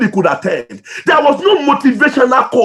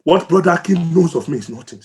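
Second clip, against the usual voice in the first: emotional; 6 semitones lower, 3 dB quieter, faster than usual. Real, not acted. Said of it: "Sorry, I'm emotional about it".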